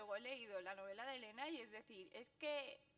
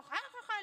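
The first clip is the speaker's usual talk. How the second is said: much higher, louder cackling ja ja ja; mock loud laugh; 10 semitones higher, 9 dB louder than usual. Mocking loud Chinese shoppers with a noisy laugh. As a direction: imitate a loud laugh in a shop.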